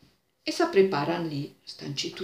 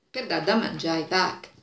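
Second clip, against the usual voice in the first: ordinary delivery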